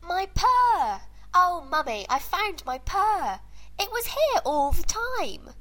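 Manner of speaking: delighted little kitten; excited kitten. Acted. Kitten finds its lost purr.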